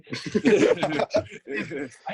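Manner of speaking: hearty laugh at own joke; loud laugh. Real, not acted. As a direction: burst out laughing at your own line.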